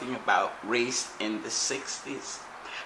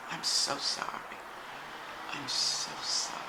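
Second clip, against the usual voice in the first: softer whisper, choked with tears; tearful whisper; 2 semitones higher, 7 dB quieter than usual. Retold or imitated. A stranger whispering to him through tears on the day King died. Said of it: "he was whispering with tears".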